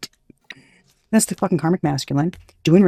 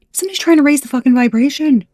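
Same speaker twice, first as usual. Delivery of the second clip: higher, louder excited delivery; 8 semitones higher, 6 dB louder than usual. Real, not acted. Vlogger thrilled by the reading, excited and loud. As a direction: rave about the hope in the cards.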